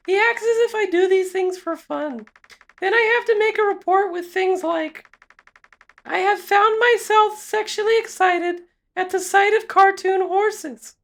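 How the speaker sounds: high feminine character voice; high female voice. Acted. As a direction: play the evaluator up high. Female evaluator voice continues.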